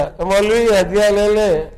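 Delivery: a blunt village man insisting, a bit loud; preacher voicing a blunt villager offering money to the cleric. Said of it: "وہ دیہاتی تو ایسے ہوتے، صاف صاف بولنے والے".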